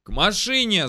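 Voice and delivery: loud, high rallying shout; yelled command. A narrator's reading. Soldier ordering comrades to the vehicle, yelling. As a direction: call the squad over at full voice. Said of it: "заорал я".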